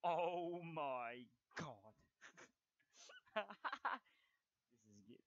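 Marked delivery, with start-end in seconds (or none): 0.0-1.9 loud, very drawn-out exclamation; loud exasperation. Real, not acted. Gamer reacts to the game, loud and exasperated. 2.9-4.0 high, loud burst of laughter. Real, not acted. Gamer laughs at the game, high and loud.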